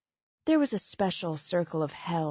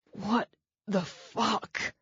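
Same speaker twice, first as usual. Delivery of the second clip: slow, strained, airless hiss; winded hiss; 3 semitones higher, much slower than usual. Acted. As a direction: squeeze it out with no breath left. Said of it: "that hissed out of him".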